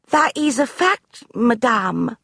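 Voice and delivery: put-on high, slow servant girl; sturdy maid answering; maid's voice. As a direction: agreeable maid to mistress.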